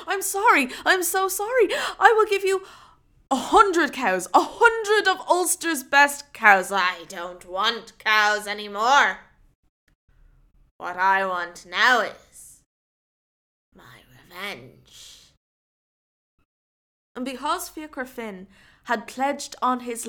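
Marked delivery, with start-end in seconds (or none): 0.1-6.7 high, loud frantic apology; apologetic. Acted. 6.7-15.3 put-on slow, low menacing old woman; vengeful old woman. Old woman vows revenge.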